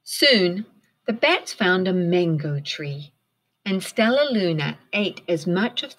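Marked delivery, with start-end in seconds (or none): none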